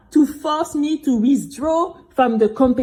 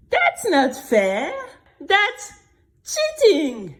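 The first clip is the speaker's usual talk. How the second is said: slow, higher outraged complaint; indignant protest; 8 semitones higher, slower than usual. A narrator's reading. Girl telling listener of the cheat, indignant. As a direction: insist it is unfair, slowly.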